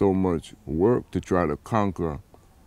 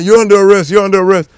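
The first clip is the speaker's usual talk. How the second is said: louder and higher, barking the arrest order as the officers did; mock officer shouting the arrest; retold arrest order; 12 semitones higher, 12 dB louder, much faster than usual.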